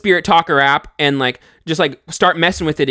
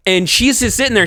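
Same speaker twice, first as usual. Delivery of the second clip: normal talking voice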